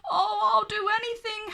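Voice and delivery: fast, high, desperate howl. A narrator's reading. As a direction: wail it in panic. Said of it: "howled Norman".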